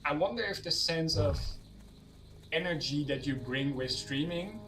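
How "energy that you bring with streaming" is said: ordinary talk, nothing marked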